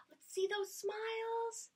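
high, bright gasp of delight. Real, not acted. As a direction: gasp as if thrilled to see them. Teacher excited to see smiles.

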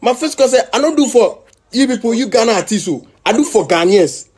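a touch of defiant bravado; quoting himself loud. Retold or imitated. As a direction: announce it like a defiant star. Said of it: "So say".

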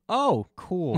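mock impressed. Real, not acted. Mocking the werewolf threat.

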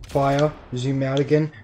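ordinary talk, nothing marked

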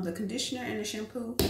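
ordinary delivery

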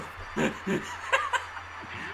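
high, wheezy breathless laugh; real laughing fit. Real, not acted. Gamer amused by own nickname for Szabvex, high laughing. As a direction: crack up at your own joke.

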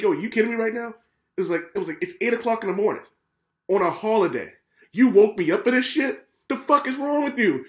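angry tirade about being woken on a holiday; retold rant. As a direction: vent at the early holiday visitors. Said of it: "And I went off".

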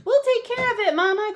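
high, loud cub voices; excited bear cubs in a high-pitched chorus; eager crying cubs. A narrator's reading. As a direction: pipe up high, promising Mama eagerly. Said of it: "cried the cubs".

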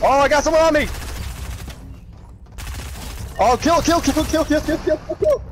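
high, urgent repeated kill chant; frantic excitement. Real, not acted. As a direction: panic as the enemy closes in. Enemy attacking him in game.

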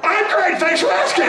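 high shouted puppet reply. Pretend. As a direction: squeal it out brightly.

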